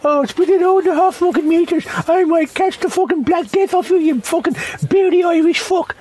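high-pitched whining complainer impression; exaggerated high moan of a fussy queuer; mock moaning.

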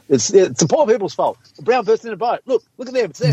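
high-pitched mock scapegoating; mock alarmed blame. Speaker mimicking banks blaming the poor, mock alarm. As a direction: point the finger in a high, put-on panic.

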